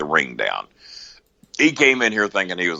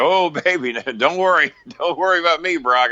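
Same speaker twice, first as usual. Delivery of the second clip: higher, sing-song fake reassurance with a laugh; mock soothing; 8 semitones higher than usual. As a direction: brush him off like calming a child. Mocking reassurance to angry Brock.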